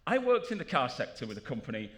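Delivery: ordinary delivery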